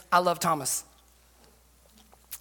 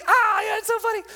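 put-on high eager kid; 11 semitones higher than usual. Son keeps fake laughing.

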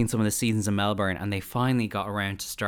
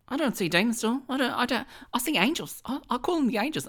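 higher, put-on Aussie voice; mock Australian Jackie; 14 semitones higher than usual. Co-host replays the psychic denying she sees demons, higher mock Australian voice. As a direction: insist sweetly like a wounded psychic in a mock Aussie accent. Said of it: "Jackie's like".

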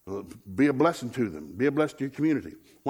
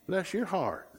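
southern put-down; 7 semitones higher, 6 dB quieter, much slower than usual. Retold or imitated. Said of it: "That's Southern for "you're stupid".

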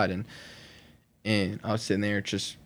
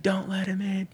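high mock holler, building louder; yelling; 11 semitones higher, 7 dB louder, slower than usual. Storyteller relives warning mom about the attacker, mock yell. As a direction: imitate a far-carrying warning, rising to a loud drawn-out cry. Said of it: "I'm yelling to the house".

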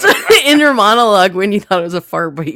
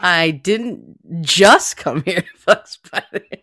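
slow, drawn out with weight on "just"; stress on just; 4 semitones lower, much slower than usual. Shown as a demonstration. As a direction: lean on "just" and let it rise. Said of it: "change the meaning of it by the word you emphasize".

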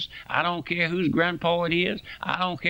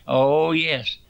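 normal talking voice

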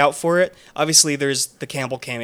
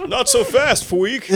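laughing mock villain taunt, higher; laughing villain line; 8 semitones higher, 4 dB louder, much slower than usual. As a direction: giggle through a villain taunt. Wanda's line repeated, laughing.

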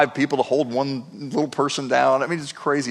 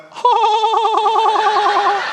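high, loud mock laugh ha ha ha; imitating a friend's high-pitched laugh; Will's high laugh; 5 dB louder than usual. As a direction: belt out a high cackling laugh. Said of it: "That's actually how Will laughs".

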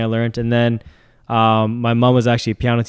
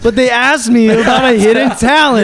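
high excited protest; 12 semitones higher, 8 dB louder than usual. Real, not acted. Defending himself, laughing.

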